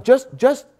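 retelling snapping at the man to listen; retold exasperated telling-off. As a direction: reenact losing patience. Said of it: "So I was trying to tell him".

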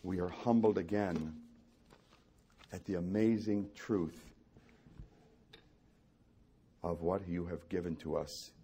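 low, hushed prayer with long pauses. Real, not acted. Preacher leading the congregation to the Father, hushed prayer. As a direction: pray softly, phrase by phrase.

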